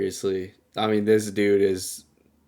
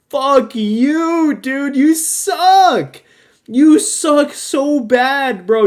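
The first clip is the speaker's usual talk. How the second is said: loud, slow, much higher outraged yell; outraged rant; 13 semitones higher, 9 dB louder, much slower than usual. Real, not acted. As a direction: yell it out in real disgust. Ranting at Penguin's cruelty.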